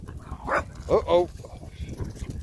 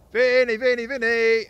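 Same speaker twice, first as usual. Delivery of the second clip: loud, higher call; calling the dog; 6 semitones higher, 4 dB louder, slower than usual. Real, not acted. Owner calling runaway dog Vinny, loud call. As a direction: shout the dog's name to call him back.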